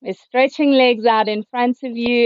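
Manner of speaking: normal talking voice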